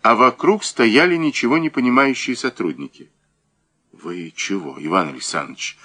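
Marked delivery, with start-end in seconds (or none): 3.9-5.8 low, hushed worried question; alarmed. A narrator's reading. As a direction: ask softly, with a touch of alarm. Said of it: "встревоженно спросил Муравьёв". (встревоженно, вполголоса)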